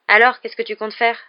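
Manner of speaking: resume as if never interrupted; nonchalant repeat. A narrator's reading. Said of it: "répéta Ron comme si rien ne les avait interrompus".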